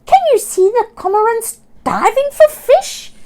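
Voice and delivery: high, loud delighted squawk. Acted. Sammy showing Oscar the sea, high delighted squawk. Seagull character voice.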